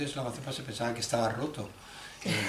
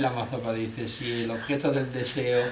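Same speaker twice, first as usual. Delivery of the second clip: ordinary talk, nothing marked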